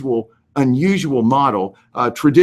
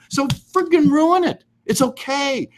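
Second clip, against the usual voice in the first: fired-up urging; 13 semitones higher, slower than usual. Real, not acted. Fired-up advice to realtor.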